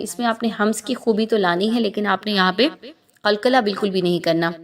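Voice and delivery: ordinary talk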